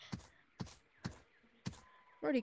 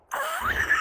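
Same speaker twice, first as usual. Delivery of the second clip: high scream of a fall; 6 dB louder than usual. Real, not acted. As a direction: scream as you drop. Falling down the hole.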